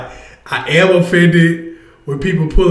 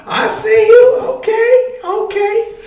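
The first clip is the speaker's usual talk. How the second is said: high squeaky greeting. Real, not acted. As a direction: voice jumps way up, laughing. Greeting viewers in the chat.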